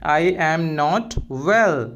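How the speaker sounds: slow, carefully spelled-out English phrase; slow and clear classroom model phrase; slow teaching demo. Shown as a demonstration. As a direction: say each word slowly for the learner. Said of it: "मतलब बोलेंगे".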